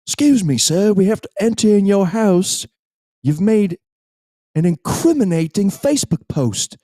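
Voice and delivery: high, loud, hammed-up British policeman; exaggerated British police accent, projected; mock British accent. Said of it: "terrible accent".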